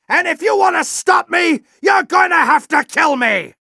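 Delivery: furious shout. Acted. General raging at Ratchet the traitor. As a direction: roar it in fury.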